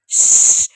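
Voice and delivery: sharp unvoiced shush; urgent shh. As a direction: hush them before he wakes. Aunt silencing loud niece.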